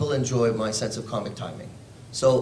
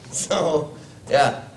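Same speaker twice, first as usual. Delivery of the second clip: ordinary talk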